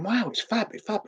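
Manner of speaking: very high, breathy-free gush of delight; imitating a thrilled fan. Fans on the street loving the rooftop gig, high gush. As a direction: gush it up high like a starstruck teen. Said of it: "and they're going".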